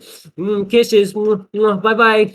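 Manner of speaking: high, cutesy kissy goodbye; exaggeratedly sweet farewell; mock-sweet teasing. Teasing blown kisses to angry man.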